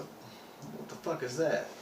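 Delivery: drawn-out, slowed amazed question; slow stunned disbelief. Retold or imitated. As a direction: let it stretch out in disbelief. Astonished at strange objects in space.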